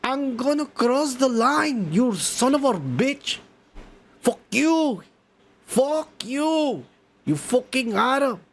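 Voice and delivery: high, shouted mock soldier taunt. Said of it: "he's right there saying".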